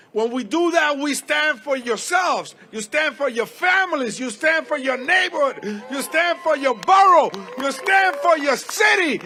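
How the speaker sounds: rising rally cry, building to a shout; rally speech. Real, not acted.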